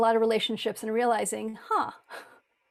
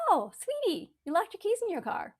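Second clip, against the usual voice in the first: sweet inner voice; 7 semitones higher than usual. Retold or imitated. Kind inner voice soothing her after locking keys, tender and high. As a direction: gently coo it, a sweet little voice. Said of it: "this little voice goes".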